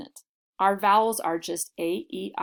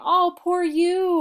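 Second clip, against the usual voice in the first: much higher and louder, slow, sing-song sympathy; pitying aww; 10 semitones higher, 7 dB louder, slower than usual. Acted. Comforting someone who got hurt, cooing mock pity. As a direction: coo over them like a hurt child. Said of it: "kinda like saying".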